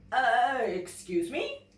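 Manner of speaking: a hint of a grumpy bear, slow; hesitant bear clearing his throat. Bear interrupting the polar bear fishing, lightly voiced. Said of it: "said Bear".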